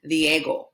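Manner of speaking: Diego, short pure E; Spanish pronunciation. Shown as a demonstration. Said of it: "but it's".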